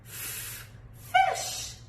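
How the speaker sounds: unvoiced f sound, then the word. Shown as a demonstration. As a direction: stretch the f hiss before fish. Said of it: "Well done".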